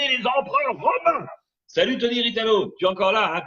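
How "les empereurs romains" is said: mock grand flourish. Grand finish on gladiators.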